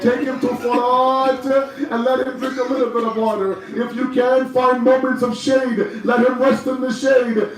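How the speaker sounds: high, impassioned mourning sermon; lament preaching. Acted.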